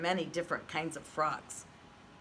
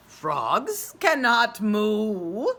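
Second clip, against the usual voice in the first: very slow, louder cow voice; 2 semitones higher, 8 dB louder, much slower than usual. A narrator's reading. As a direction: drag it out like a lazy cow. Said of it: "said the cow".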